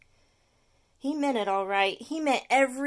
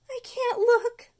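much higher, slow, louder whimper; whimpering sister; 13 semitones higher, 8 dB louder, slower than usual. Acted. Sister Allison whimpering at the mess. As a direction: squeak it out, eyes shut. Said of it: "whimpered his sister Allison".

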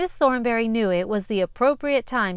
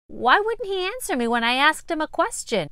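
put-on puzzled boy; 7 semitones higher than usual. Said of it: "asked Tyler".

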